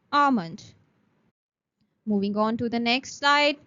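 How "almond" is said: "Almond" said with no L, as "ah-mond"; silent L almond. Shown as a demonstration. Teacher repeating the word for students to copy. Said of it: "The L in almond is silent".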